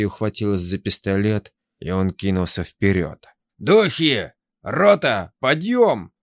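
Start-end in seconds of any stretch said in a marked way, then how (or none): 3.6-6.1 high, loud battle cry; furious yell. Acted. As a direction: bellow orders in frenzy. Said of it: "заорал Даня".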